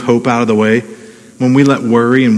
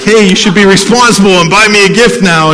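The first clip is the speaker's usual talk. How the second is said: higher, louder mock parental scold; 6 semitones higher, 5 dB louder than usual. Pastor imagines scolding his adult kids, mock scolding. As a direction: pretend to scold the grown kids. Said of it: "I feel like I should be upset".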